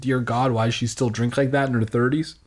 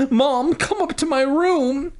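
sobbing; 13 semitones higher than usual. A narrator's reading. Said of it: "she sobbed".

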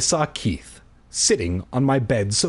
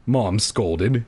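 plain reading voice

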